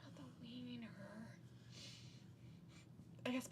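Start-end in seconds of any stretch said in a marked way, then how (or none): 0.0-0.9 whispering. Real, not acted. Joking whisper aside.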